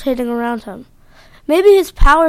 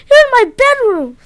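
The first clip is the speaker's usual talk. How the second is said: indignant; 9 semitones higher, 6 dB louder than usual. Acted. Boy confronts intruder.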